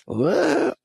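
high rising disbelieving what; incredulous what. Retold or imitated. Mocking the merch claim, a high disbelieving squeak.

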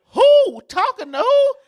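high, loud "who?" in mock surprise; mock surprised. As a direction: squeal "Who? Talking to who?" as if caught off guard. Acted dialogue, mock surprised reply.

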